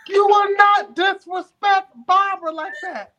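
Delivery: slow, high, loud stern reprimand; mock scolding. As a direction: scold like a strict teacher.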